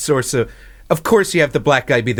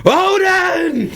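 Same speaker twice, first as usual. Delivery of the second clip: high-pitched roar to the heavens; hammed-up high yelling; mock-epic scream; much slower than usual. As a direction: scream the name like a melodramatic thunder god's son. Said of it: "screaming the name".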